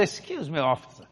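soft, low, polite request. Acted. Driver to a big officer, meek and polite. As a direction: address him softly and courteously. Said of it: "I was polite".

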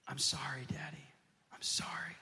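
soft whispered sorry; whispered apology. Retold or imitated. Ashamed son to his father, whispered sorry. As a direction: breathe the apology, barely voiced.

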